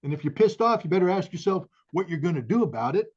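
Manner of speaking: rising to a furious battle cry; pissed off. Real, not acted. To the host, a Marine vowing to fight: real fury. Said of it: "You better get pissed off".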